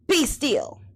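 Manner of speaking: a hint of a boy commanding monsters; calm them with a firm little order; a boy king taming the wild things, lightly firm; lightly voiced commanding Max. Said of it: "Till Max said".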